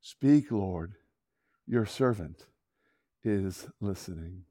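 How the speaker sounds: low, halting, reverent reply; hushed reverence. Acted. Boy answers God at last, hushed and reverent. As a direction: answer softly and humbly, pausing between phrases.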